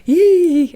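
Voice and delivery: sudden high squeal of disgust; high loud yelp. Real, not acted. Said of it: "It is so".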